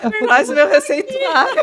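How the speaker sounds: high, loud laughing quip; joking through laughter. Real, not acted. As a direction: call it out while cracking up. Joking after host forgets the question.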